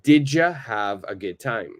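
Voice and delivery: "Did ya" fused as "didja"; reduced speech. Shown as a demonstration. Teacher modelling "Did ya" for repetition. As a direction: say it linked for students to repeat. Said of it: "repeat after me".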